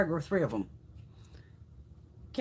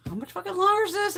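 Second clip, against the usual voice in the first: high, fed-up retold complaint; mock exasperation at the endless movie; exasperated outburst; 12 semitones higher than usual. Exasperated retelling of long movie.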